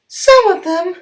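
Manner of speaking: very high, loud bright engine voice; very high cheery diesel voice; Mavis the diesel. Acted. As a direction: pipe up high and brisk. Mavis answers.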